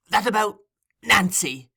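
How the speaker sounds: high, pointed reminder. A narrator's reading. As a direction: press the name firmly and high.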